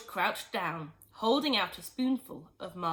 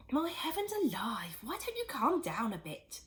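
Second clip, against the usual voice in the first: Missus Cranky voice; 4 semitones higher than usual. A narrator's reading. Missus Cranky telling her excited husband to calm down. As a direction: chide him gently. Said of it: "Missus Cranky said".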